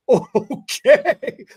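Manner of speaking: high, laughing okay; laughing in disbelief at the cashier. Retold or imitated. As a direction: squeak okay through a laugh.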